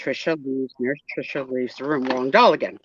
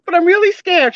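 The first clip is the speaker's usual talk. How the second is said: high, loud frightened plea; a scared patient begging not to go on; patient pleading with the nurse, high and loud and scared; 14 semitones higher, 9 dB louder than usual. Acted. Patient doll voices fear.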